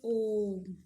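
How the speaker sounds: ओम stretched to triple length; slow drawn-out ओम; prolonged om. Shown as a demonstration. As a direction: hold the ओम long like a chant. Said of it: "हम ओम करके बोलेंगे तो हमारा तीन गुना समय".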